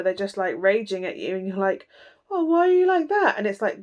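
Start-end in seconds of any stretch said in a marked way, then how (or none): 2.3-3.4 much higher, louder outraged whine; mock indignant whine. Reader imitating the querent whining at the angry friend. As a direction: squeal it in fake hurt offence.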